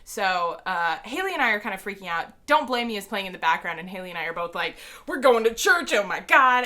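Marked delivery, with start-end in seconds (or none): 5.1-6.7 loud, higher, gushing outburst; starstruck shriek. Retold or imitated. Two fans reacting to their song playing, loud and ecstatic. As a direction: let it burst out in fan ecstasy.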